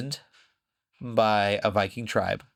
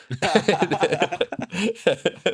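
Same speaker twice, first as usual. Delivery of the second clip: a burst of real laughter, high and loud; laughing; 8 semitones higher, 4 dB louder than usual. Real, not acted. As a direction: laugh loudly at your own retelling. Laughs at own story.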